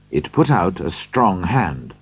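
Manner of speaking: usual speaking voice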